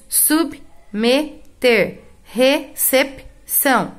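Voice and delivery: submeter and recepção said very slowly; slow example words. Shown as a demonstration. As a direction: stretch each word for the class. (palavras ditas bem devagar)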